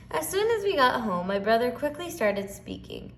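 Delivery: ordinary delivery, nothing marked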